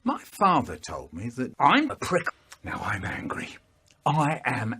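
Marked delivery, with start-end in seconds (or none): 2.6-3.5 whispered, lower and softer; angry whisper. Acted. Admitting anger in a whisper, lower and softer. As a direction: hiss the anger under breath.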